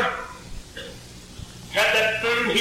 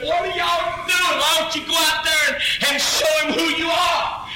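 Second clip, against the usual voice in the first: David taunting brothers; 8 semitones higher than usual. Acted. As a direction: goad them to go fight.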